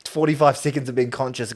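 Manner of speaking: normal talking voice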